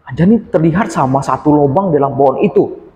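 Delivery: ordinary delivery, nothing marked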